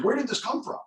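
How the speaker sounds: high, alarmed question; a jolt of scared disbelief; mock alarm at a leaked screenshot; startled panic. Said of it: "I got scared for a second".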